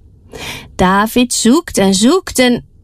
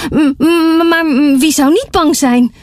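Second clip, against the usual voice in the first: nervous stammer, voice pitched up; 7 semitones higher than usual. A narrator's reading. Frightened traveler stammering to the others. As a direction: stutter it out, scared traveler. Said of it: "Een derde stamelt".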